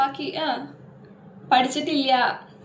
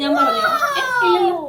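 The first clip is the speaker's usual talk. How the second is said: mock indignant squeal; 9 semitones higher, 6 dB louder than usual. Teacher scolds pupil, mock indignant.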